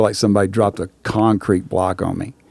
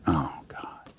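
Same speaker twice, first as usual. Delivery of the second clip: despairing whisper; 8 semitones lower, 17 dB quieter than usual. Retold or imitated. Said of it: "And I'm like".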